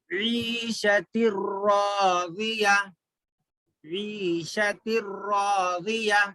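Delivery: ईशतिर रादिया slowly, tanween merged into a rolled, doubled र; merged-ra recitation. Shown as a demonstration. Teacher showing pupils how to join tanween to ra, merged recitation. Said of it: "रॉ को मिला के पढ़ना है".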